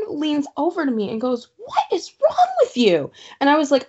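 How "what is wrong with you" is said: high-pitched imitation of an appalled friend; hammed-up "what's wrong with you" voice; mock incredulous friend. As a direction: act out a friend's squeaky disbelief. Friend's appalled reaction, retold.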